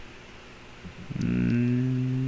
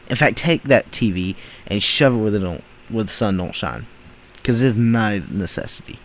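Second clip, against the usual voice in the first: ordinary talk, nothing marked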